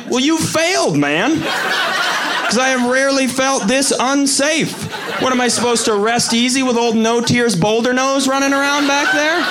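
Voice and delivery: outraged disbelief. Retold or imitated. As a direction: drag out the disbelief, way up high. Comic outrage at friend.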